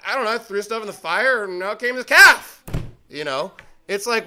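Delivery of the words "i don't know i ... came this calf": making it up. Acted. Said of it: "make up stuff on the fly".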